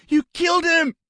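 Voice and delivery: high, loud sobbing cry. A narrator's reading. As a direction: wail it through sobs. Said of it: "he sobbed".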